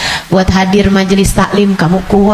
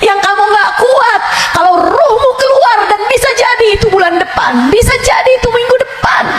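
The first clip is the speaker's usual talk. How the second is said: much higher, shouted sermon; fiery preaching; 12 semitones higher than usual. Acted. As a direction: preach the torment at full force. Preaching about death. (ceramah berapi-api, suara tinggi berteriak)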